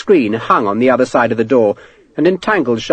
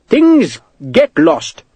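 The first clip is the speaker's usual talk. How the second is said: very slow, higher-pitched excuse; shrugging; 6 semitones higher, much slower than usual. A narrator's reading. Clerk excusing lost horseshoes, shrugging. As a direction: draw it out with a verbal shrug. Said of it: "The clerk shrugged".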